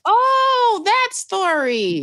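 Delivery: a high, loud oh, then that story drawn out slowly; light up as the memory clicks; sudden recognition. Real, not acted. Guest suddenly remembering the story the host means, surprised recognition. Recalling which story is meant.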